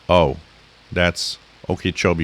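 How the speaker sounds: ordinary delivery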